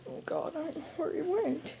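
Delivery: hushed scared whisper; whisper, hiding. Acted.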